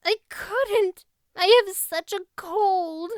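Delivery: very high, upset plea; a wretched girl near tears, pitched high; tearful girl. Acted. Anne, disgraced, answers.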